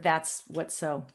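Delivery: That's what's so said slowly and softly; slow, deliberate phrase. Shown as a demonstration. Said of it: "now you can start with".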